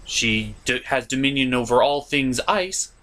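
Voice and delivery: ordinary delivery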